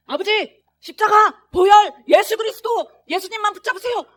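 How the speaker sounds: high, loud shouted plea; loud urgent crying out. Retold or imitated. Son crying out to his father, loud urgent plea. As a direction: shout the words like a lifeline.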